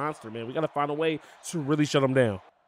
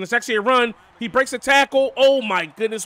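loud, high burst of real excitement; yelling as the runner breaks free; excited outburst; 7 semitones higher, 6 dB louder than usual. Real, not acted. Big run breaks open.

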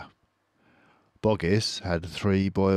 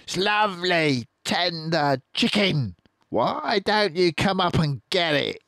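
high taunting coax; mocking farmer; 12 semitones higher, 4 dB louder than usual. Boggis to the fox, high mocking coax.

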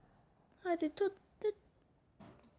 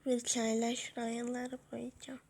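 unvoiced whisper; 8 dB quieter than usual. Real, not acted. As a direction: whisper it to yourself. Whispers while editing.

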